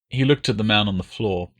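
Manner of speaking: ordinary delivery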